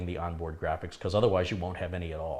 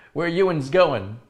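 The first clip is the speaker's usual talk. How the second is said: mock Texan accent; 6 semitones higher, 8 dB louder, slower than usual. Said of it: "Texans also say Ewans".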